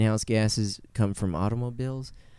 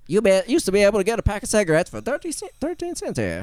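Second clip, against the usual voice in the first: put-on high, fast old-timer telling tales; old-timey voice; 11 semitones higher than usual. Shown as a demonstration. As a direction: play a chatty old-time local. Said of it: "talk like the old-timey voice the whole time".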